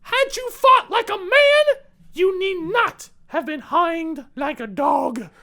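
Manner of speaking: loud, high, slow Irish accent attempt; a hammed-up Irish accent. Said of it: "I can't do an Irish accent".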